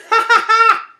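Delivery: high loud ha ha ha; loud cartoon laugh. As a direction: belt out a big laugh. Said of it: "People laughed".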